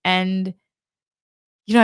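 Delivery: usual speaking voice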